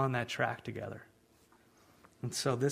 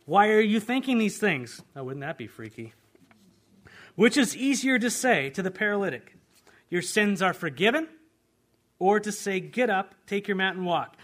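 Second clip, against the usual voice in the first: Jesus challenging; 6 semitones higher, 7 dB louder than usual. Acted.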